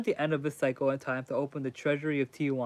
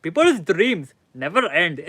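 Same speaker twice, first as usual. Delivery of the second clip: put-on a light, joking Indian accent, a bit louder; 3 semitones higher, 8 dB louder than usual. Streamer jokingly does an Indian accent for chat, lightly. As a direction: play a half-hearted Indian accent for laughs. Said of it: "That's the best Indian accent you're gonna get from me".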